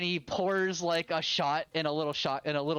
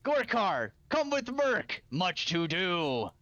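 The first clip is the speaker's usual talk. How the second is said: Merc's voice. Acted. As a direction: boss the young fighter along. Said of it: "chat, this is Merc".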